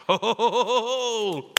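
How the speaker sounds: loud, high mocking ho-ho-ho laugh; gloating laugh. Critics laughing at Jesus, a loud mocking ho ho ho. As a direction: cackle like a smug critic.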